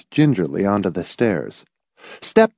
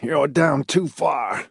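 slow, higher-pitched, understated character voice; dispassionate; 5 semitones higher, 4 dB quieter, slower than usual. A narrator's reading. Laws telling Hamilton he's out of reach, dispassionate. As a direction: state it slowly without emotion. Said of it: "Laws said dispassionately".

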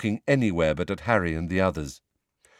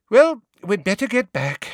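sighing; 13 semitones higher, 6 dB louder than usual. A narrator's reading. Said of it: "sighed Missus Longbottom".

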